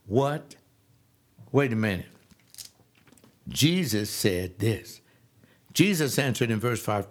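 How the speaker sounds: ordinary delivery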